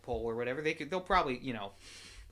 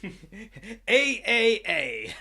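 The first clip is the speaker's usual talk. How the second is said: laughing; 6 semitones higher, 9 dB louder, much slower than usual. Real, not acted. Laughing hammed-up sound.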